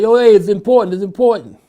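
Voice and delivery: high, loud, urgent shout; urgent call. Retold or imitated. Friend urging him over first, urgent loud call. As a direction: shout it so he has to come now. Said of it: "Both of them was calling me".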